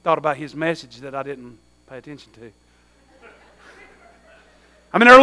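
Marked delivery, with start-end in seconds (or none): 0.0-2.5 much softer, lower nervous murmur; the caught listener thinking in dread; mock sheepish panic, hushed; panicked inner thought. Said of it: "they're like looking at me like".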